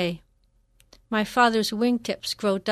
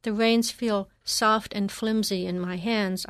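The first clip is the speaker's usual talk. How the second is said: ordinary delivery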